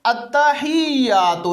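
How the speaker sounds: التحیات said very slowly, syllable by syllable; slow teaching demo of التحیات; careful pronunciation. Shown as a demonstration. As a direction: draw out التحیات slowly and clearly. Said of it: "یہ لفظ ہے".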